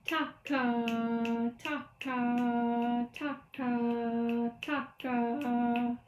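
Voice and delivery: short TA, long held KA, chanted; even, drawn-out TA-KA rhythm; slow rhythm demo. Shown as a demonstration. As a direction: chant slowly, sustaining each KA. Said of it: "So again, slowly".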